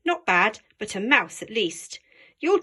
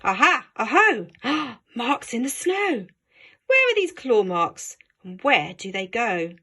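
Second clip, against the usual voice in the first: put-on excited child; 3 dB louder than usual. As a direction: call out eagerly. Child finds claw marks.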